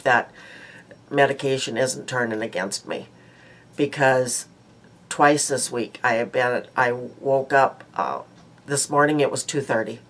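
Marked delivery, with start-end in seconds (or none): none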